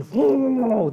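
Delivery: high, swooping "whooo" of wind; long whooshing howl, gliding down; whirlwind noise. Shown as a demonstration. Preacher imitating the whirlwind for listeners, howling whoosh.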